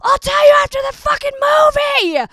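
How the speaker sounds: high, loud mock-angry shout; the sister losing it in the cinema, shouting; furious outburst. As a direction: sister finally snaps and yells at her. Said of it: "and she was like".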